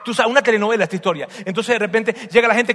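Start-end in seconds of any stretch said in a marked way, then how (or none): none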